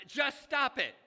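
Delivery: loud high barking yell; a mock shout of stop it; imitated yell. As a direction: yell it like the fed-up psychiatrist. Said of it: "he yells at them".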